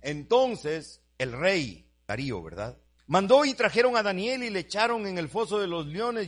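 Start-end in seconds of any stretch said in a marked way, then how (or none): 3.1-6.3 higher, louder sermon narration; preaching at full force. Acted. Preacher tells the congregation of Daniel in the lions' den, raised up. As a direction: drive the story forward from the pulpit.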